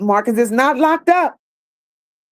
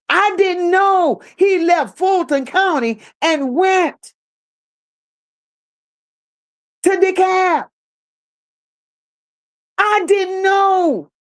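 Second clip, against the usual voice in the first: higher-pitched, drawn-out disbelief; real surprise; 6 semitones higher than usual. Real, not acted. Host hearing he left Fulton County, surprised. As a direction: stretch it out high, taken aback by the news. Reacts to news from a commenter.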